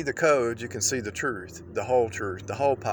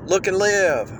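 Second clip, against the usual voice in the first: loud, high preaching refrain; 6 semitones higher, 4 dB louder than usual. Acted. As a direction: call it out like a preacher.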